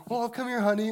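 high, cooing imitation of a comforting mom; consoling mother. Mother keeping a straight face for her weeping son, cooing comfort. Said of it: "She has to keep a straight face".

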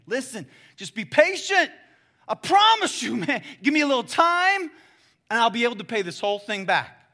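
high, loud desperate pleading; begging. Acted. Servant on his knees begging the king, loud and desperate. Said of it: "falls down and starts begging".

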